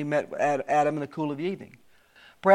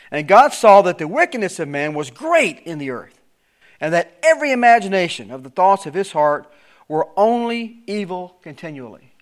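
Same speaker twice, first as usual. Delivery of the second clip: preacher reading the King James text aloud; scripture reading; 6 dB louder than usual. Acted. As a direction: proclaim the verse with solemn weight.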